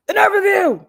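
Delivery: high, loud diva exclaim; sassy outrage. Retold or imitated. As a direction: throw shade, loud and high. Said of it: "oh, I was about to say".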